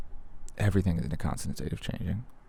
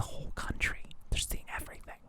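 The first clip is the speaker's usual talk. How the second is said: hushed awed whisper; 13 dB quieter than usual. Retold or imitated. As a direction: breathe it out in a whisper. Whispered part of quote.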